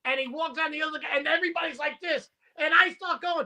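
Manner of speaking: ordinary talk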